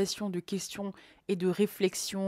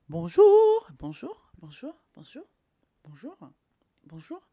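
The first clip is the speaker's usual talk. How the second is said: varied greetings; 3 semitones higher, 7 dB louder than usual. Shown as a demonstration. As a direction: play each bonjour in a new pitch and volume. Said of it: "Évitez d'avoir le même ton tout le temps".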